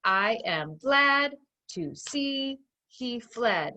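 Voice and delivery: very slow, one beat per word; pounded out syllable by syllable. Shown as a demonstration. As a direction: tap out each word evenly, slowly. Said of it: "So listen again".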